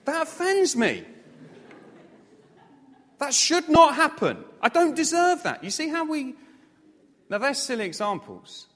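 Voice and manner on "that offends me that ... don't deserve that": high, loud, hammed-up offended protest; mock indignation. Preacher after a bird pooed on him, mock offended protest. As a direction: play the wounded victim for laughs.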